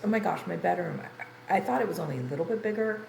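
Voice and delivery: ordinary talk, nothing marked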